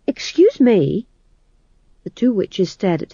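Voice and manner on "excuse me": higher, louder slow excuse me; insistent timid fool; bumbling fool. Acted.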